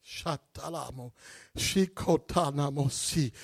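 soft murmured tongues; speaking in tongues. Acted. Preacher praying in tongues, murmured. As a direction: pray in tongues under the breath.

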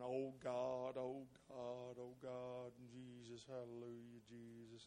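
low, hushed, intense praying under the breath; murmured prayer. Retold or imitated. Danny praying, retold.

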